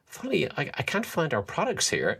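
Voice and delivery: high, pretend-puzzled young salesman; mock innocent. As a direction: play it high and naive. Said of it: "And I go".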